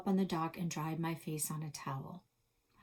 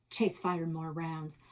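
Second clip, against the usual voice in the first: ordinary delivery, nothing marked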